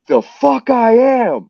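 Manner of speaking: high-pitched, drawn-out indignant holler; retold indignant cry; defiant retort. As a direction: stretch it out high, reenacting outraged defiance.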